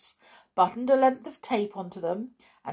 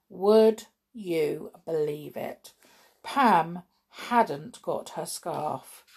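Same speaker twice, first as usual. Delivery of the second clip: ordinary delivery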